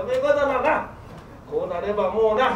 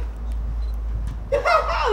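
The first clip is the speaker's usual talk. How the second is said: high breathy stage laugh; 3 dB quieter than usual. Acted. Bride nods, he laughs high.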